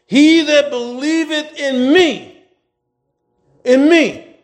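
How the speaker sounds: slow, stressing "in me"; drawn-out emphasis on "in me"; emphatic. Shown as a demonstration. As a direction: lean hard on "in me". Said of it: "That's emphatic".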